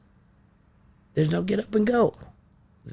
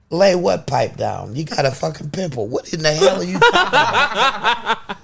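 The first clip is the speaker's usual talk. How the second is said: laughing mock outrage; 8 semitones higher, 6 dB louder than usual. Mocking the twins, laughing.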